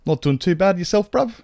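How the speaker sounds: a cheeky Londoner asking back; British accent. Pretend. Answering a viewer in a hammed-up British accent. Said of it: "that's enough British now".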